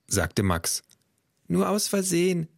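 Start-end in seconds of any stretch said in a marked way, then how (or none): 1.5-2.5 put-on high little-girl voice; little Tiffany. Tiffany defending grandpa to mum, high small voice. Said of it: "sagte Tiffany".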